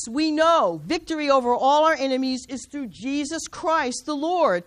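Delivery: ordinary talk, nothing marked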